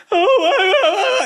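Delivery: high, hammed-up whimpering sobs; mock crying. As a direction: mimic her crying to ridicule it. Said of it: "She's going".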